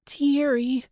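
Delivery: high, strained wheeze of a name; wheezed cry. A narrator's reading. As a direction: wheeze out your friend's name. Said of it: "I wheezed as he lifted me".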